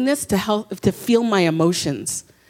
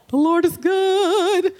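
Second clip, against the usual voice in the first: high, drawn-out mock-pious singsong; hammed-up everything-is-fine front; forced cheerfulness; 10 semitones higher, slower than usual. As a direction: overdo the fake brightness, stretching the words. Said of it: "totally masking up".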